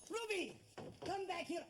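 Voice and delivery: high, urgent summons; calling sharply back. Acted. Butler calling the runaway kitchen maid back, peremptory. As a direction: order someone back at once.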